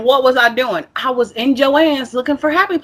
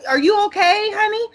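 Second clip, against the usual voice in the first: lightly mimicked wary clerk, pitch climbing; mock concern, lifting into a high question; concerned store clerk; 10 semitones higher than usual. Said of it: "they're looking at you like".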